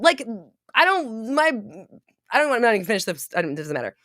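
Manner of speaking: normal talking voice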